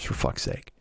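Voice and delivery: whispered under the breath; exasperated mutter. Retold or imitated. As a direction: drop to a fed-up whisper. Exasperated aside to mom.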